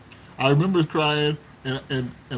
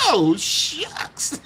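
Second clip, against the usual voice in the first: put-on bashful boy; 11 semitones higher than usual. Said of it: "I'm like".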